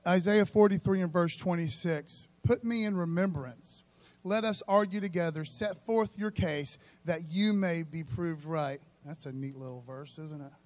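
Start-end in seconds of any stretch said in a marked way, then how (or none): 0.1-8.8 louder, slower, measured Bible reading; scripture reading. Acted. Preacher reading Isaiah to the congregation, slow and solemn. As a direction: read the verse out with weight.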